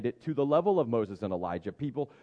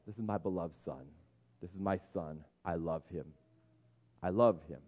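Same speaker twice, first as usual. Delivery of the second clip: softer, gentle declaration of love; tender father; 7 semitones lower, 6 dB quieter than usual. Retold or imitated. God speaking over his beloved son, softly tender.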